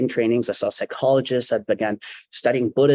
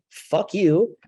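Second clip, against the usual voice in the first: mock yell at normal volume, pitched up; recapped outburst; 7 semitones higher than usual. Storyteller replaying what passengers overheard, mock yell. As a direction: toss off a high imitation of his outburst. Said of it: "that all heard".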